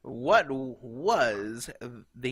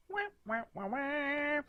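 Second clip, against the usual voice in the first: sad trombone; 5 dB quieter than usual. Pretend. Mock losing buzzer.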